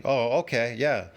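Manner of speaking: normal talking voice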